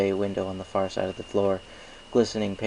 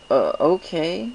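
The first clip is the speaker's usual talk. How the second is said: unsure; 9 semitones higher, 6 dB louder, slower than usual. Acted. Chaser unsure.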